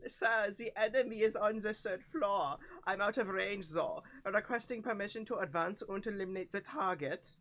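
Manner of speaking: put-on German-accented soldier's report; put-on German military voice. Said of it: "we're never gonna get away from the fucking German accents".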